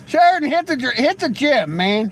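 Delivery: loud, high call across the room; teasing shout to a viewer in chat. Real, not acted. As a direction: holler the order at him, high and loud. Calling out to chatter Sheridan.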